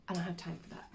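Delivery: breathy whispered aside. Real, not acted. Whispering an aside to the viewers. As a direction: drop to a quick whisper, brushing it off.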